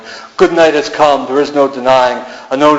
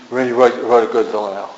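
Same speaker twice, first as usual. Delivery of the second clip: ordinary delivery, nothing marked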